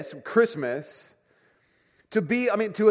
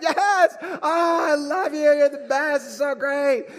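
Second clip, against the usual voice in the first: high, loud, gushing praise; thrilled dad cheering his son on; overjoyed praise; 10 semitones higher, 4 dB louder than usual. Retold or imitated. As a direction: celebrate like he scored a goal. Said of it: "that kind of confidence".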